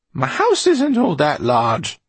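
loud, high, drawn-out complaint; exasperated outburst. A narrator's reading. Eleanor breaking the silence in the locked cellar, exasperated. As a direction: raise the voice high and stretch the words.

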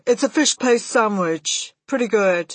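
a hint of a loud-mouthed boy relishing food. A narrator's reading. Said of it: "rather loud-mouthed Bruno".

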